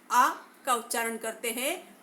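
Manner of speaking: normal talking voice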